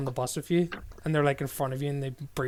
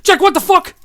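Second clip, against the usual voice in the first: shocked shout; 14 dB louder than usual. Real, not acted. Sudden outburst at friend.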